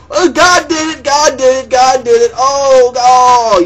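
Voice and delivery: high, loud whiny chant of God did it; mock the believers in a shrill, loud repeated chant. Mocking people who just say God did it, high and loud. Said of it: "instead of just going".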